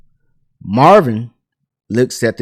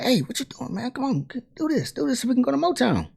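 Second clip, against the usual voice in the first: high, very soft pleading voice; hushed urging; 12 semitones higher, 12 dB quieter, faster than usual. Retold or imitated. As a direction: urge your friend under your breath, high-pitched. Friends urgently nudge struggling singer.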